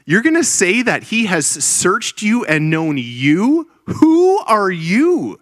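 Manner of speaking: how dare you, asked in slow amazement; incredulous challenge. Pretend. Preacher voicing disbelief at David's claim, incredulous, high and slow.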